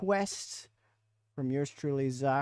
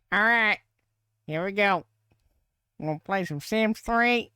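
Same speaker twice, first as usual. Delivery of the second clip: higher, louder hillbilly drawl; 5 semitones higher, 4 dB louder than usual. Acted. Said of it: "voice impression of a hillbilly redneck".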